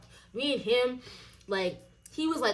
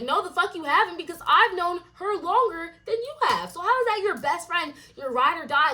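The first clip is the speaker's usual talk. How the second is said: loud, high indignant retort; heated comeback; 8 semitones higher, 6 dB louder than usual. Retold or imitated. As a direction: throw his words back at him, higher and louder. Angry retold argument.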